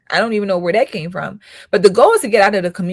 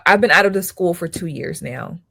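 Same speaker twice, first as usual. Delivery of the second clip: ordinary delivery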